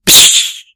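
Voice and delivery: unvoiced psst of air escaping; hiss of a seal. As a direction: breathe out a sharp seal hiss. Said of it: "I heard this".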